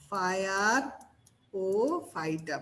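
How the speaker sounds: "ফায়ার ও ফাইটা" in three parts with long pauses; broken-up pronunciation. Shown as a demonstration. Tutor spelling out the trick, "ফায়ার ও ফাইটা" piece by piece. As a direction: split "ফায়ার ও ফাইটা" into fire, o, phyta. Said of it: "ভাই উচ্চারণ করতে বলছি হচ্ছে".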